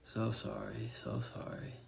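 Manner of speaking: soft, fading mutter dropping to a whisper; delirious mutter sinking into a breath; muttering in delirium. A narrator's reading. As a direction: mumble it half asleep, trailing off. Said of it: "he continued to mutter".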